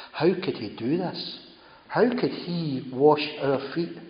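slowed, wondering questions. Real, not acted. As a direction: let the disbelief sink in slowly. Preacher voicing disciples' wonder.

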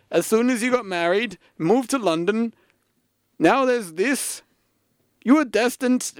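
a bad Yorkshire old dad, lamenting; Yorkshire accent. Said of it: "in a Yorkshire voice, which I can't do".

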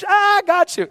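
high-pitched triumphant cry; spring the surprise in a high shout; gleeful gotcha. Retold or imitated. Dad pranks his own surprise-party guests, high gleeful gotcha.

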